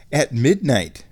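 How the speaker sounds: usual speaking voice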